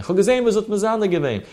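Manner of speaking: high, quick protest without much volume; high-pitched mock outcry; mock shrieking mother-in-law. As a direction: imitate a mother-in-law crying out her accusation. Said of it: "Der Schwieger schreit".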